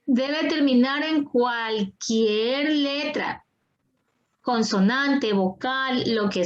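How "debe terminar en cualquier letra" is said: "cualquier" drawn out slowly, syllable by syllable; slow, deliberate teacher stress on "cualquier"; emphatic rule-giving. Real, not acted. Teacher spells out rule slowly for student. ("cualquier" alargado y recalcado, muy despacio)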